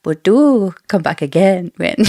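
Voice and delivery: light South American lilt as Queen Marlies; a hint of a South American accent, regal. Podcast host voicing Queen Marlies in a South American accent. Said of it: "I really like her accent for Queen Marlies".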